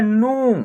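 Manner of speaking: नू with a held ऊ; long vowel. Shown as a demonstration. Teacher showing न with the matra, नू held long. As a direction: draw out the vowel in नू. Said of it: "आप long तरीके से उच्चारण".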